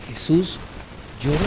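very soft, pausing between words; hushed. Real, not acted. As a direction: say it tenderly, almost under the breath. Hushed climax of consolation.

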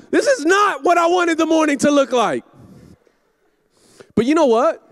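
usual speaking voice

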